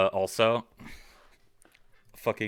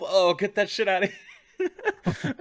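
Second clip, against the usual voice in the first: high laughing mock outrage; 12 semitones higher, 4 dB louder, slower than usual. Real, not acted. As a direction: laugh through the line. Laughing while acting.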